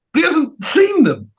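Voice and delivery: very high, incredulous squawk; hammed-up indignant falsetto; mock outrage. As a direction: protest in squeaky disbelief. Acts the sculptors protesting.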